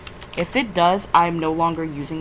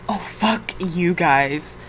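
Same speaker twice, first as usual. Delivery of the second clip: normal talking voice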